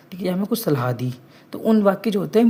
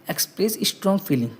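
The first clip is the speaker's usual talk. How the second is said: slow dictation; 2 semitones higher, much slower than usual. Shown as a demonstration. Teacher dictating definition to class.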